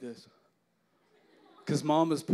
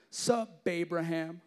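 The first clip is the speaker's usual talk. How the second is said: casual pickup line; slower than usual. Shown as a demonstration. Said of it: "just say this".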